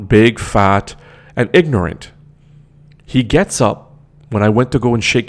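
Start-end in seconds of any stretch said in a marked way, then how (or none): none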